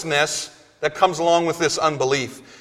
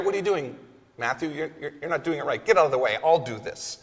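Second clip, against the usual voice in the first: lower, softer mock squabble between the disciples; bickering disciples; 5 semitones lower, 9 dB quieter, faster than usual. Preacher voices one disciple scolding Matthew, hushed and bickering. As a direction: grumble it like a disciple elbowing a friend aside. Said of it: "you can hear the argument going on".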